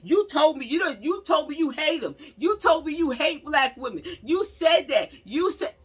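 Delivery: loud, high, heated imitation of her; hammed-up angry girlfriend; mock outrage. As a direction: mimic her yelling at him. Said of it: "She was like".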